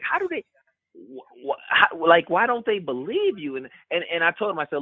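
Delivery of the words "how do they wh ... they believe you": high-pitched, stammering disbelief; imitated outrage. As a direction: sputter as if indignant on someone's behalf. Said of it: "he was almost outraged".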